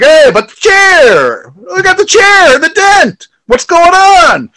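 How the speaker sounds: very high, loud, drawn-out protest; hammed-up bewildered wail; mock frantic shouting. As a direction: imitate an outraged wrestler yelling. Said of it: "He's like".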